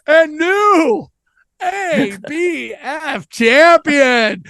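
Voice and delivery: high, slow, drawn-out announcer call; hammed-up wrestling announcer crowning brother; ring announcer. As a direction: stretch champion like a ring announcer. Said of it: "I'd be like".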